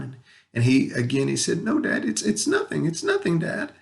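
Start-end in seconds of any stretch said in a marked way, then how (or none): 1.6-3.7 imitating a boy holding back tears, higher voice; choking back tears. Son brushing off his worried dad, choking back tears. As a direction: retell it small and high, trying to be brave. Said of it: "he was just choking back these tears".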